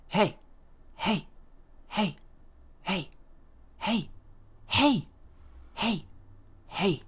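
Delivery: high, short, repeated heys; insistent spirit voice. Retold or imitated. Unseen spirit calling a sleeper over and over, insistent. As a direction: imitate a voice nagging for attention. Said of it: "over and over and over and over".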